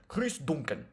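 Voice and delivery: a light attempt at a Scottish accent; amateur Scottish accent on a fighter's name. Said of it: "how do you do a Scottish accent?".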